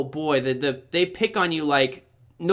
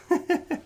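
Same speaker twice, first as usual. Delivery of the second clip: short, high-pitched giggle; a quick burst of real laughter; real chuckle; 14 semitones higher than usual. Real, not acted. Host reading the chat to himself, short chuckle.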